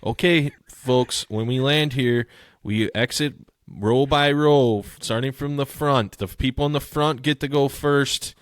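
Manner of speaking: hammed-up announcement spelling out the obvious; mock flight attendant. Joking to his cohost, announcing exit rules like a flight attendant. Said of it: "Why can't they just say".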